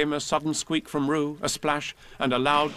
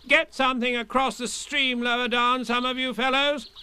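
higher bossy call; 12 semitones higher than usual. Acted. Rabbit calling orders to the others. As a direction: order them down the stream. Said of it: "called Rabbit".

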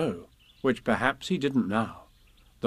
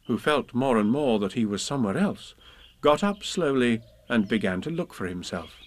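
ordinary delivery

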